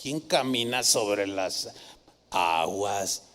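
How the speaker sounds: deep mock disdain of a doubter; scornful skeptic. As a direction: drag it out like a know-it-all mocking the miracle. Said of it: "los que explican todo dirían".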